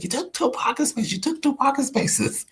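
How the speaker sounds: high mock-flustered woman's voice; a high, hammed-up flustered stranger; retold yell. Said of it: "she yelled at me".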